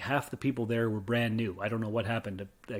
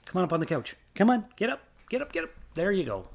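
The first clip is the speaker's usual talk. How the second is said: coaxing a dog; 6 semitones higher, faster than usual. Real, not acted. Coaxing dog onto couch.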